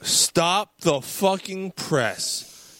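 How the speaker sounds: slow, incredulous outburst building high; stunned disbelief. Real, not acted. Fan learning co-host never saw Pulp Fiction, real mounting disbelief. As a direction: stop everything, then explode on the last word.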